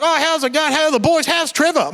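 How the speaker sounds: lightly voiced jolly uncle; cheery uncle. Uncle Barry greeting the family, bright and cheery. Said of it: "Barry's like".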